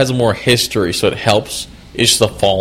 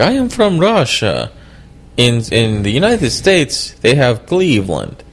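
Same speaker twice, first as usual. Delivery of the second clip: put-on slow, deadpan Russian accent; heavy russian accent menace; 4 semitones higher than usual. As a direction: do Yakov Smirnoff's Russian accent. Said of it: "Yakov Smirnoff used to have a joke".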